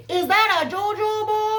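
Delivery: high, loud, hammed-up Minnesota accent; deepest Minnesota accent. Waitress greeting a customer at breakfast, thick mock Minnesota accent. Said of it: "in the deepest Minnesota accent".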